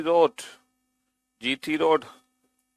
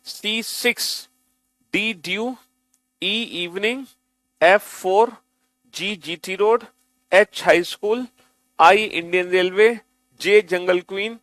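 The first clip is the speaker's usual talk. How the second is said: slow, higher chanting of the pairs; sing-song recital; 3 semitones higher, 3 dB louder than usual. Shown as a demonstration. Teacher reading the list aloud for students, sing-song. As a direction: recite each pair like a rhyme. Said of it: "कैसे पढ़ना है देखो".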